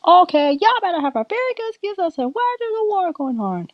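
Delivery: high-pitched telling-off; scolding mother. Acted. Di scolds everyone about the war.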